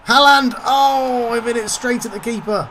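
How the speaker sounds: loud, high cry fading in volume; exasperated shout. Real, not acted. Gamer reacting to Haaland's missed chance, exasperated shouting. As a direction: yell the striker's name, then wail the miss.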